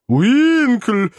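high call of a name. A narrator's reading. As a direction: call to a companion, pitched well up. Calling out to his companion. (высокий оклик)

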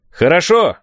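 high, loud call; shouted acknowledgement. A narrator's reading. Hidden man accepts the boys' answer, calling back. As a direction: shout approval from a distance.

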